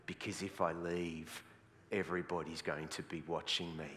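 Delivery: low, slow, quiet dread; dismayed churchgoer. Acted. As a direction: mutter it like a trapped, reluctant listener. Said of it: "there's the other group who are going".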